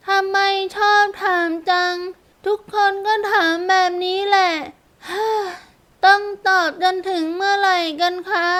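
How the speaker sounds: put-on high-pitched small child voice; a child tired of the question, sighing; little girl. A narrator's reading. Girl weary of everyone asking, high child voice.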